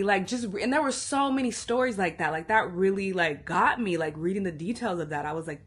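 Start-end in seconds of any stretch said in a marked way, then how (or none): none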